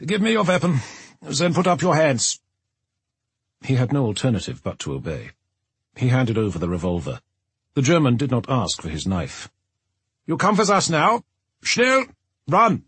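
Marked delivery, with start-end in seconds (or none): 0.1-2.4 put-on high-pitched German-accented command; German soldier disarming a captive; German soldier's order. German soldier orders captured pilot. 10.3-12.8 put-on high, urgent German-accented orders; raised German-accented command; German soldier's command. German soldier orders prisoner to move.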